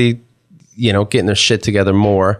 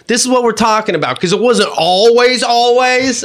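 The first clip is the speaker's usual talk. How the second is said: mock exasperated, pitched high, stretching the last always; exasperated argument; 13 semitones higher than usual. Husband arguing with his wife over dishes, pitched-up mock outrage.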